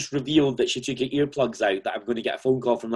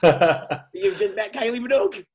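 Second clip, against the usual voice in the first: higher and slow, laughing through it; mock gushing, laughing; 10 semitones higher than usual. Host admitting jealousy of the guest meeting Kylie, laughing. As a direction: let the laugh leak into the mock envy. Retelling own jealous thought.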